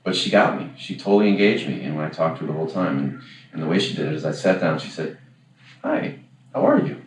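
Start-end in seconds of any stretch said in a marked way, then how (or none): 5.8-7.0 much higher, bright imitation of an eager woman; imitating a chatty woman who truly wants to know; sincere friendly greeting. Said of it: "sounds like she really meant".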